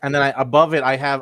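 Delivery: usual speaking voice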